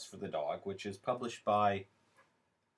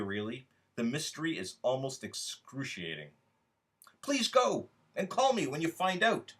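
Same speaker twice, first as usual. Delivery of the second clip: expressive literary reading, speaking to you; story reading; 4 semitones higher, 3 dB louder than usual. A narrator's reading. Author reading his short story to online voters, expressive reading. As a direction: read it as a wry, intimate monologue.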